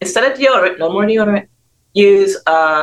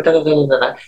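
normal talking voice

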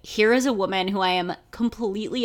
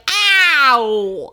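a loud, higher cockney "Ow!" like Eliza Doolittle; 5 semitones higher, 7 dB louder than usual. Retold or imitated. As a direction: yelp it in a full cockney, Doolittle-style. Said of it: "a full, like, Eliza Doolittle".

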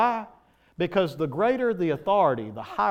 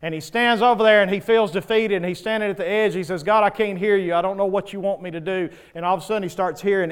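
loud preaching; 4 semitones higher, 6 dB louder, faster than usual. Acted. Preaching the story with force.

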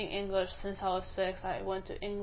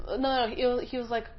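usual speaking voice

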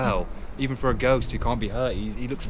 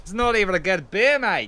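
high Aussie bloke scoffing; mock the beer in a broad Australian voice; Australian accent; 8 semitones higher, 4 dB louder than usual. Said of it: "the Aussie way".

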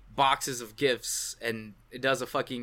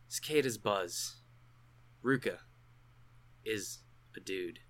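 dramatic inner monologue. Acted. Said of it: "I just wanna do the inner monologue part for Okabe".

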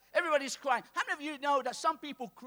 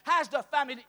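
usual speaking voice

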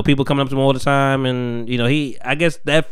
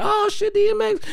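pitched way up, hyped crowd yelp; excited crowd. Retold or imitated. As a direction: gasp like a stunned fan. Crowd reaction imitated.

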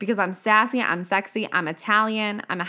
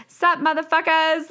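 high cheeky "Sup, motherfuckers?"; brash greeting; 8 semitones higher, 4 dB louder than usual. Retold or imitated. Showing up online, greeting followers with cheeky swagger. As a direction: toss it out like you own the room. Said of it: "she posted a video in lingerie being like".

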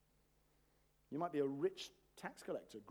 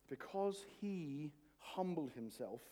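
usual speaking voice

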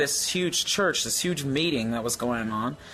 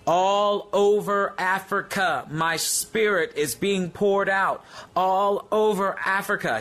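proclaiming shout; 5 semitones higher than usual. Retold or imitated. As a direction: announce it like God shouting over a continent. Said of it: "He just started shouting that".

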